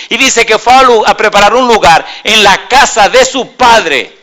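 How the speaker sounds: loud, high-pitched preaching; preaching at full force. Real, not acted. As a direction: proclaim it to the whole congregation, loud and high.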